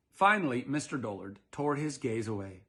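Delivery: ordinary delivery, nothing marked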